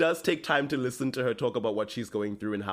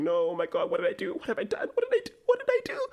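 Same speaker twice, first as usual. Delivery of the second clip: panicked wail climbing very high; mock crying; 8 semitones higher, faster than usual. Podcaster acting out Bonnie's guilt, mock sobbing wail. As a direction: wail it like a hammed-up crying fit, going higher each time. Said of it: "crying and going".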